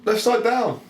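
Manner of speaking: high, loud call of the result; excited announcement. Real, not acted. Co-host calling out the figure-flip result, loud. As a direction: announce it like a game show.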